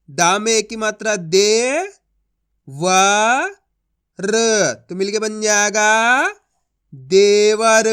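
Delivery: slow and loud letter by letter; slow spelling-out demo. Shown as a demonstration. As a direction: stretch each syllable, then say they were loudly. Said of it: "अब चलिए आगे देखिए".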